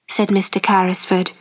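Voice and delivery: ordinary delivery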